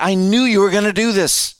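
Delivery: I-told-you-so at God; exasperated Jonah. Retold or imitated. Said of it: "he said it about like that".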